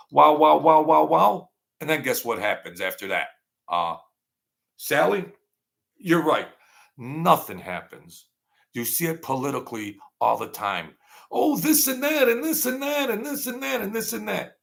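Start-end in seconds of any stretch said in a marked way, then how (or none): 0.1-1.5 mock hype. Mocking media hype. 11.3-14.6 high, mocking sing-song gabble; mock chatter. Host mocking politicians who talk but do nothing, high mock gabble.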